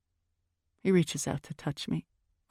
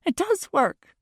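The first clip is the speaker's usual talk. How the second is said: high, louder snap back; snapping; 9 semitones higher, 3 dB louder than usual. A narrator's reading. Girlfriend fighting to save the relationship, snapping. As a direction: snap it back at him, pitched up. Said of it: "I snap at him".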